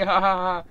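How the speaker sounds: loud sarcastic ha ha ha; mock your own bad joke with a forced laugh; forced sarcastic laughter; fake laugh.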